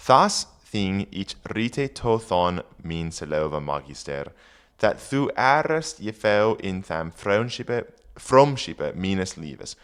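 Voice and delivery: put-on reading Old English aloud; careful Old English recitation. Shown as a demonstration. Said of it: "reading Old English aloud".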